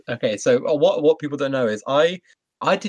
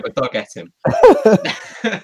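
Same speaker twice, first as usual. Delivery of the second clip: louder, higher, laughing through the words; 8 semitones higher, 8 dB louder, much slower than usual. Real, not acted. Podcast host joking about the missing fifth member, laughing. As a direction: crack up on your own punchline.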